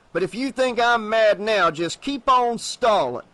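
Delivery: Tupper threatening. Acted. Sheriff Tupper warns the stalling salesman, threateningly. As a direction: lean into the warning. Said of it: "Tupper interrupted".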